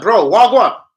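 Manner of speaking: loud, high baffled "what"; mock confusion. Kid baffled by the sectarian insult, loud mock confusion. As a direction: act totally baffled.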